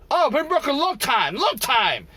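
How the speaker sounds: high mocking broken-English voice; mock worker accent. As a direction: imitate the workers' clipped report. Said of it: "I'm like".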